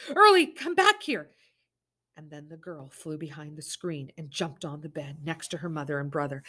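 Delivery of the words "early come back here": high, loud screech. Acted. Mother screeching at her daughter to come back. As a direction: scream it in panic, much higher. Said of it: "Summer screeched".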